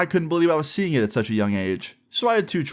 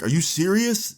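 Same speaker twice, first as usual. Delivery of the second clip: high-pitched question of disbelief; incredulous; 8 semitones higher than usual. Retold or imitated. Brothers hearing about the babysitter, acted incredulity. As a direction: imitate brothers who can't believe it.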